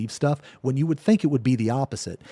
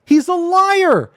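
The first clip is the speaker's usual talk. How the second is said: much higher, slow, loud He's a liar; angry; 7 dB louder, slower than usual. Real, not acted. Streamer to viewers about the accuser, angry declaration. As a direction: spit out the accusation.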